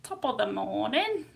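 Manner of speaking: playful mock Cockney; Cockney accent. Shown as a demonstration. Streamer showing off an accent, mock Cockney. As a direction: put on a stock Cockney greeting accent. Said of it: "That's the Cockney accent".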